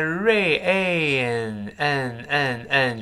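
slowly stretched "rain", then repeated front n; slow breakdown of "rain" into its n sound; nasal n. Shown as a demonstration. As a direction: draw out the n with the tongue root down. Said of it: "是个鼻音".

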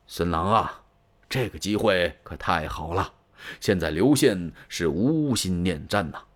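lightly voiced elder giving sly advice; old advisor.

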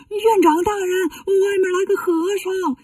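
high, drawn-out cry for the abbot; panicked calling. Acted. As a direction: call out in hammed-up alarm. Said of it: "跑到后院喊老和尚". (惊慌地拖长声高喊)

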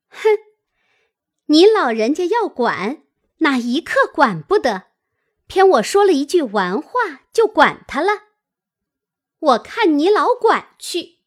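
loud, high-pitched sarcastic challenge; cold sneering. A narrator's reading. Offended girl lets go and challenges the aunt, sneering. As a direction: dare her with scornful indignation. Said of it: "冷笑道".

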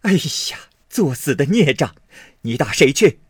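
high scolding that climbs to a loud shout; frantic scolding. A narrator's reading. Frightened mother grabbing her son, scolding shout. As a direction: curse him and dare him. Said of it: "骂道".

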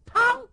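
high, loud bang of a kicked door; door crash sound. Acted. Storyteller imitating the door being kicked open, loud bang. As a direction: slam out the kick.

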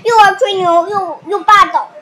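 loud sulky complaint. Acted. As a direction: vent loudly to mom. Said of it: "小熊妹妹大声说". (大声抱怨)

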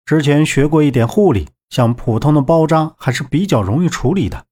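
ordinary delivery

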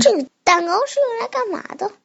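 high, fast little rabbit. Acted. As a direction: squeak it like a rabbit. Child redoes Rabbit's line.

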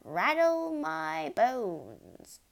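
high, very slow, drawn-out wail; spooky parrot voice. A narrator's reading. Parrot imitating a spooky voice, eerie and drawn-out. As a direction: draw each word out like a ghost. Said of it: "wonderful imitation of a spooky voice".

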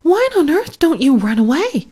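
high horrified exclamation; Pigling horrified at the pig's answer, high exclaim. A narrator's reading. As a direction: cry it out, appalled. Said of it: "exclaimed the horrified Pigling".

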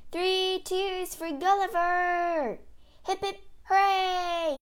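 high, drawn-out triumphant cheer. Acted. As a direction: lead a crowd in slow hurrahs. Crowd cheers the hero.

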